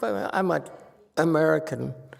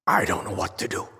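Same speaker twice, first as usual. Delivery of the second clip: worried plea; 10 semitones higher than usual. Retold or imitated. Worried patron calling for help.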